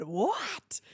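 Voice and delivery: mock-shocked whispery "what"; imitating a stunned, breathy reaction; astonished neighbor. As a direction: gasp it out in disbelief. Retelling neighbor's astonished reply.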